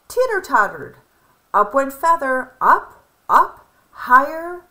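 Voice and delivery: usual speaking voice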